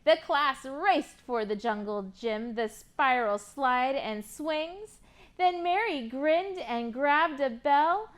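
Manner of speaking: rhyming picture book read with lively lilt; reading to children. Acted. Teacher reading a rhyming book to kids, lively. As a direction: read the rhymes to a class of kids.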